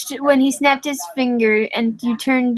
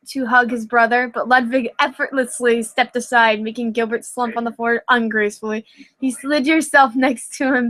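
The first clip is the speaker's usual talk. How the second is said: plain reading voice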